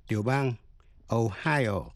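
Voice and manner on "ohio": "Ohio" stressed on HI; second-syllable stress. Shown as a demonstration. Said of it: "Nhấn mạnh vào vần nhì". ("Ohio" nhấn vào vần nhì HI)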